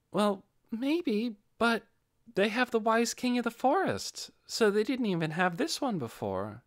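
put-on the boy Inferia trails off, unsure of himself; Inferia's voice. Inferia to the group, halting and high-pitched.

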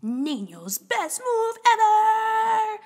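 high, loud, slow build; hyped exclamation. Acted. Reader hyping Niño's best move, high and loud. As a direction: announce the big move proudly.

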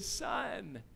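dad gently explaining days to son, softly; patient dad. Retold or imitated. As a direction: calmly reason with a small child. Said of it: "And I said".

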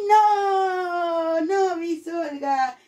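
long held refusal, then sing-song; children answering. Acted. Teacher voices pupils rejecting the match, drawn out. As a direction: stretch it out like a class chorusing.

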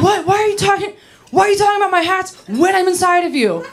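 high, incredulous imitation of a lover; worked-up outrage. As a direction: act out a high, indignant protest. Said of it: "he was all worked up".

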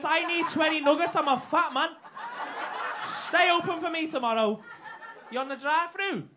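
loud, hammed-up fuming rant at the staff; mock furious rant. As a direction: yell it like a wronged, starving customer.

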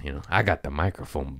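ordinary talk

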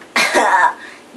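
short loud laugh. Real, not acted. Vlogger breaking off before the next topic, laughing.

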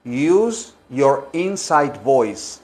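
"use your inside voice" muy lento y suave; maestra paciente. Shown as a demonstration. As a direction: como a un niño que grita. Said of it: "Dicen, "Mijito". (maestra a un niño, muy lento)

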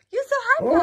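very high, loud squeal; high-pitched delighted cry; squealed excitement. Real, not acted. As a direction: gasp and squeal at the dog. Excited at the hyper dog.

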